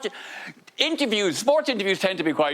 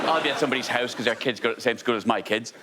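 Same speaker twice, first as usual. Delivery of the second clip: ordinary talk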